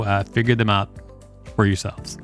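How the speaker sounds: ordinary delivery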